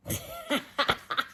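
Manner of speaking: high amused burst of laughter; real laugh. Real, not acted. Reader laughing at the stomach punchline, high bursting laugh.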